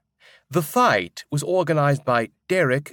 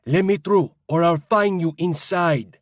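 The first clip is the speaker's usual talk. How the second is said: raised, higher shout pushing through a crowd; 6 semitones higher, 4 dB louder than usual. Acted. Stranger shouting at an ogre blocking the line, loud and high. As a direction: bark it over the crowd at the ogre. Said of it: "and shouting".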